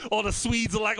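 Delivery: ordinary talk, nothing marked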